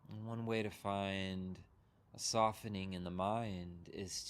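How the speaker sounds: unhurried meditation guide, long pauses between phrases; guided meditation. Real, not acted. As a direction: guide them gently into settling, leaving room to breathe.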